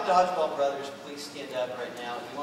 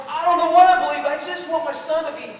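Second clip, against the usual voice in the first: high outburst starting very loud; distraught father; 10 semitones higher, 8 dB louder than usual. Acted. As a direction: plead desperately for your son. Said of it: "he's messed up in the head".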